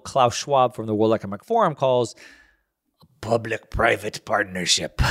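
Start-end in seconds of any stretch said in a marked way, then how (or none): 3.0-5.0 mock German accent, slightly slower and measured. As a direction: put on a hammy German accent. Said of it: "In my best German accent".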